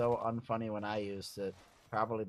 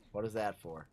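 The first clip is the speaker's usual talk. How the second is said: usual speaking voice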